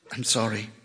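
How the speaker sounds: low, soft apology; hushed and low. A narrator's reading. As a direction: apologize gently, voice dropped. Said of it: "she said softly".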